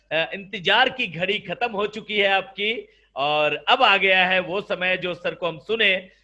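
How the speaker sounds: usual speaking voice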